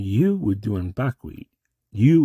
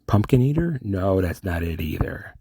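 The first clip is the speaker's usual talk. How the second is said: ordinary talk, nothing marked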